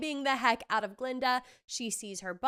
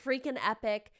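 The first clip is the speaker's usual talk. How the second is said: ordinary talk, nothing marked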